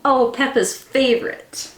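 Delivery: ordinary delivery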